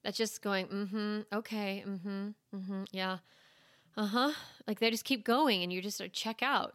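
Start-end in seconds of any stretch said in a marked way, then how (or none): 0.7-4.3 soft, absent mm-hmms while checking out; half-listening murmur; neutral listening. Shown as a demonstration. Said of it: "you practice your neutral listening".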